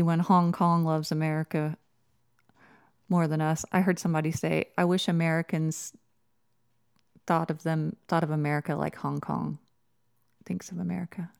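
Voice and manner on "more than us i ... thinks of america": ordinary talk, nothing marked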